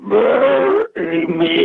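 high, slow wailing ghost; scary voice. Retold or imitated. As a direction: wail it like a rotting ghost. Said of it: "really scary voice".

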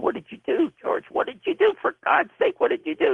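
high-pitched frantic scream, held back in volume; retold screaming. Host demanding answers from the show's owner, mock high-pitched scream. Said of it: "I start screaming at him".

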